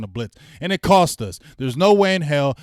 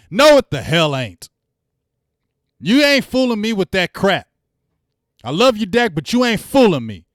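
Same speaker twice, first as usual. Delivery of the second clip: higher and louder, flatly refusing it; angry retort; 7 semitones higher, 7 dB louder than usual. Real, not acted. Fan snapping back at Dak's speech, loud and angry. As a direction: shut down the quarterback's spin. Rejecting Dak's press talk.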